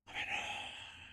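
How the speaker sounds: long, soft, breathy sigh; weary sigh. Acted. Employee waiting on the boss's verdict, weary sigh. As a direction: let out a long quiet exhale. Sighs while waiting for her answer.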